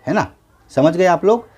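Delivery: ordinary talk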